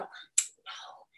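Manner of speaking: gentle correcting whisper, slow and airless; slow soft unvoiced whisper to a child. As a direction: coach a child in a true whisper. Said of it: "and you go".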